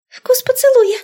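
очень высокий испуганный писк ученицы; пискни тоненько, как мышка. A narrator's reading. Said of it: "пискнула Индира". (тоненьким перепуганным голоском)

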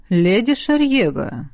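slow, hoarse call of the name. A narrator's reading. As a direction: rasp her name, drawing it out. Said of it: "Хриплый голос раздался совсем близко".

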